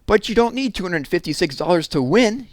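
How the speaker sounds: high, loud shout. Acted. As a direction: shout it at your brother. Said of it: "she shouted".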